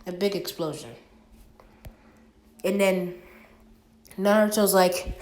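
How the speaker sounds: ordinary delivery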